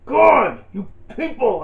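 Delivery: loud, high exasperated "God"; exasperated outburst. Real, not acted. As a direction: blow up at the viewers. Exasperated at viewers' comments.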